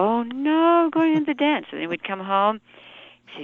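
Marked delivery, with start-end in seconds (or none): 0.0-1.6 put-on higher-pitched oh no of a kid in trouble; lightly acted childhood dread; mock dread. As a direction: groan it like a doomed child. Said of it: "And so you go".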